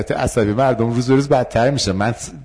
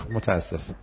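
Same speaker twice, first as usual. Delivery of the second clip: quiet calm sorry; 3 semitones lower, 9 dB quieter than usual. Shown as a demonstration. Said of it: "این تکنیک سوزن گیر کرده".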